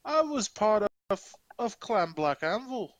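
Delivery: put-on horrible Scottish accent. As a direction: declare your clan in mock Scottish. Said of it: "Competing with my horrible Scottish accent".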